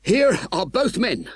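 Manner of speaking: high, out-of-breath gasp; panting. A narrator's reading. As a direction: gasp it after a struggle in the ditch. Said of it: "panted the sergeant".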